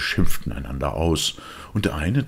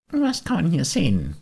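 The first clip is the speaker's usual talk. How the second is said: put-on high, longing puppet boy; a boy pulled toward the music; wistful high boyish voice; Bengele's voice; 3 dB louder than usual.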